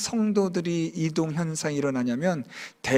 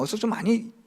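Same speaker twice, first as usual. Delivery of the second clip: ordinary talk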